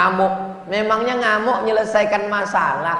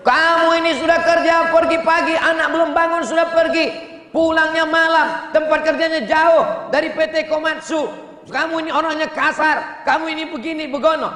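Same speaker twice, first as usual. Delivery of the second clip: nagging wife; 10 semitones higher than usual. Retold or imitated. Wife scolds her hardworking husband, high nagging voice.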